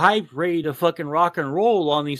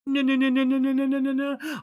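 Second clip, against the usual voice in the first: higher-pitched, rapid string of no's; mock inner voice; 9 semitones higher, faster than usual. Server refusing to shortchange the burned customer, mock high voice.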